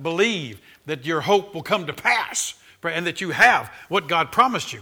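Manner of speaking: normal talking voice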